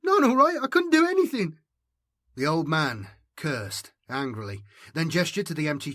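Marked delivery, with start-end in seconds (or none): 0.0-1.6 put-on very high, loud sobbing boy; loud, high tearful wail; wailing sob. A narrator's reading. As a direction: break down in tears.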